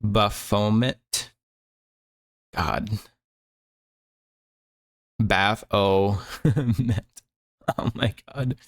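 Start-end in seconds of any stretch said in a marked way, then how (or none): 0.0-1.3 Baphomet as buh-FOE-mit, very slowly; Baphomet sounded out, "buh... FOE-mit"; pronunciation attempt. Shown as a demonstration. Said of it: "How the hell do you say that". 5.2-7.0 Baphomet as BAFF... OH... met, very slowly; Baphomet stretched out, "BAFF-OHHH-met"; exaggerated sounding-out. Shown as a demonstration. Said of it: "How the hell do you say that".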